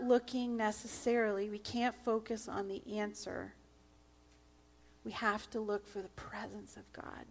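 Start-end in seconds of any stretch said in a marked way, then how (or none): 5.0-7.3 whispered, intimate emphasis. Real, not acted. Pastor whispers the key point to the church. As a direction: drop to a whisper to draw them in. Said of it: "whispered emphasis in sermon".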